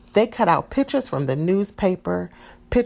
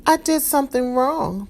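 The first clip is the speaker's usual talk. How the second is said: sobbing; 8 semitones higher than usual. A narrator's reading. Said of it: "Maria sobbed".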